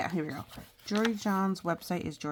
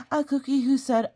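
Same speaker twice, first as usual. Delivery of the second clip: higher and much louder; excited cookie; 6 semitones higher, 9 dB louder than usual. Acted. The cookie getting excited telling the child about solving puzzles. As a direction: build up to the big moment.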